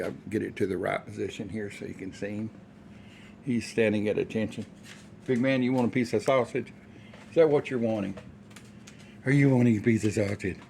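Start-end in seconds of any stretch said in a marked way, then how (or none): 5.3-10.6 loud, eager pet talk to a dog; loud doggy talk offering sausage. Real, not acted. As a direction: coax the dog with a treat, loud and bright. Talking to his dog.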